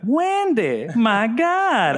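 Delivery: high-pitched quoted line; movie quote voice. Retold or imitated. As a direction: squeal it like the movie character. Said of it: "And I was like".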